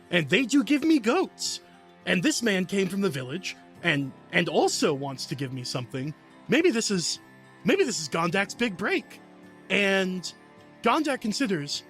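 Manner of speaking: ordinary talk, nothing marked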